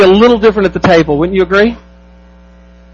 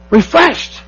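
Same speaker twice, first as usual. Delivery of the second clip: sigh of relief; 6 semitones higher, 4 dB quieter than usual. Retold or imitated. Sighing relief of feeling refreshed.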